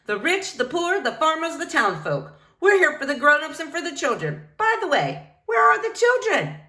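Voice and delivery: a grand librarian suddenly puzzled; bold librarian voice. Acted. Rosalita voiced by reader.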